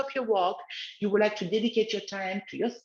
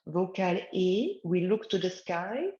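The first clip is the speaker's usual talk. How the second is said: guided relaxation; 4 semitones lower than usual. Acted. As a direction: lead gently, eyes closed. Leads the exercise again.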